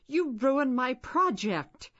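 loud, high, slow accusing yell; a boy yelling at the little sister who wrecked his project; angry shout. A narrator's reading. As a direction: shout at her through tears of anger.

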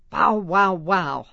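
mock dog bark for kids. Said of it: "Bark like a dog".

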